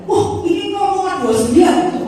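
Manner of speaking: loud, high, drawn-out exclamation; alarmed doctor. Retold or imitated. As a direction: act out a doctor's startled alarm. Doctor exclaims at scan result.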